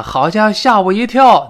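loud, high startled exclamation. Acted. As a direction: sound like the noise made you jump. Acting the startled caller.

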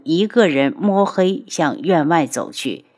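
ordinary delivery, nothing marked

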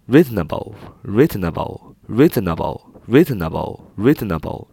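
"Reasonable" punched on REA, then falling; stress on first syllable. Shown as a demonstration. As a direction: hit the start of "reasonable" each time. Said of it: "重音在一开头". (reasonable重音放在开头的rea上)

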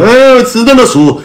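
high-pitched mock sob; mock-tearful, high wailing reply; imitated crying. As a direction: whimper it like a crying kid, put-on. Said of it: "哭了，说".

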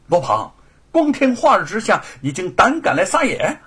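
loud, high stern scolding; angry rebuke. Acted. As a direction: confront the intruder fiercely. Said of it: "他愤怒之下". (愤怒地呵斥)

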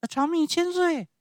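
very high, loud puppet voice; polite little Pinocchio. Acted. Pinocchio blesses the showman, high and polite. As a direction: pipe up like a small child.

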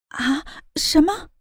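stunned, slow, rising gasp of disbelief; shocked surprise. A narrator's reading. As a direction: say it slowly in sheer disbelief. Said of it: "猫夏一脸的不可思议".

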